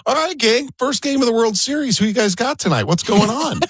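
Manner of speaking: high-pitched upbeat greeting to a whole room; positive, smiling voice. Retold or imitated. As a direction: imitate a big personality rallying strangers with a smile. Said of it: "in this really positive, smiling voice".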